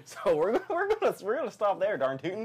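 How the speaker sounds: high loud hammed-up cowboy; yee-haw it like an old prospector. Comic cowboy sign-off.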